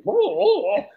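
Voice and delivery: high-pitched run of whoas; startled, high retold whoa; mock hyper reaction. Retelling reaction to being hyper.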